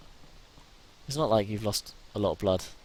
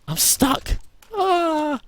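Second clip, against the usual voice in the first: high, loud scream of panic; trapped by zombies, loud terrified scream; panicked scream; 8 dB louder, much slower than usual. Real, not acted. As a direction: scream as you get caught.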